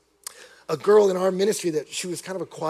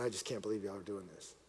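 very low, hushed voice; understated tearful disbelief, without sobs; retold sobbing man; 10 semitones lower, 14 dB quieter than usual. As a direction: murmur it, quietly overcome. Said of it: "he just started sobbing".

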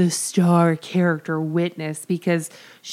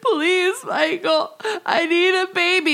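high, tearful pleading imitation; mock crying; 9 semitones higher than usual. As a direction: beg through hammed-up sobs. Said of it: "So she's just crying".

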